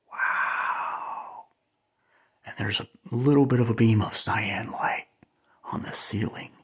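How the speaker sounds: breathy hushed wonder; awed whisper. Real, not acted. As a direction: whisper it like you don't want to break the moment. Filming the disco light beam.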